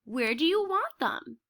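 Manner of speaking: plain reading voice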